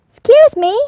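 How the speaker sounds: loud call up to a tall animal. Acted. Little hippo calling up to a giraffe, loud call. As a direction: call up high to the treetops.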